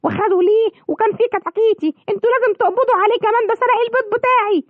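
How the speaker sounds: high, fast, frantic hen cry for help; panicked hen. Acted. Hen begging the police to arrest the thief, panicked. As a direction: squawk out the theft in a panic.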